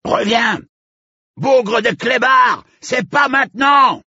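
yelling. Acted. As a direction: bellow after the runaway dog. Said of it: "hurla la voix de Magnier".

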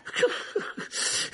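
loud, breath-heavy mocking chuckle; scornful villain laugh. Teacher laughs at his enemy, mocking. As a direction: laugh derisively at him. Rogue mocks Lupin.

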